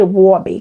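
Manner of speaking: "Robbie" with W in place of R; imitated client's accent. Vlogger insisting this is how her client said it, accented. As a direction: say "Robbie" like "Wawby", vowel rounded. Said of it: "she would definitely say".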